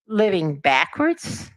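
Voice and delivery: plain reading voice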